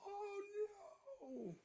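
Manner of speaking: cartoon wail. Streamer voicing the panicked cartoon man, high whimpering wail. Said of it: "he's doing, like, the whole".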